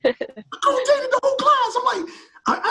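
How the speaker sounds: very high, squealing through laughter; laughing, high-pitched disbelief; laughing incredulously. Real, not acted. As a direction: crack up at your own story, voice shooting way up. Incredulous retelling of funny memory.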